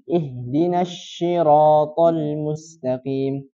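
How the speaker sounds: siratal said with a sh sound, slowly; slow recitation with sh for s; shin instead of sad. Shown as a demonstration. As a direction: demonstrate the mistaken shiratal. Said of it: "Kalau enggak shin".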